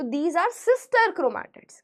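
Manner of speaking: every word stretched out slowly; slow dictation. Shown as a demonstration. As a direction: spell it out slowly for notes. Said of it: "dictating slowly". (धीरे-धीरे notes लिखवाना)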